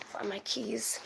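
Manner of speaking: unvoiced whisper to herself; quiet breathy whisper. Real, not acted. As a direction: hush it out so nobody wakes. Whispering near the dog.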